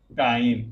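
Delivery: फाइन said low and slow, with a long "aai" sound; teacher demo. Shown as a demonstration. Teacher says फाइन slowly so children hear it. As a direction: model the word फाइन clearly for the class. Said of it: "ये क्या हो जाएगा?".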